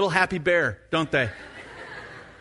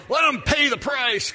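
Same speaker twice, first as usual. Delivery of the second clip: a higher, shouted dad line, hammed up; mock stern dad; 7 semitones higher than usual. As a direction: play the dad who lets his son learn the hard way. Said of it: "kind of thing".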